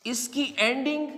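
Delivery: ordinary talk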